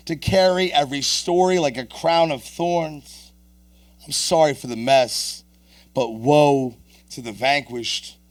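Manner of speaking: declaimed slam poetry, lifted and rhythmic; impassioned poetry recitation; spoken-word poem. Acted. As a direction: perform it to the room, softening into the last confession. Performs the announced poem.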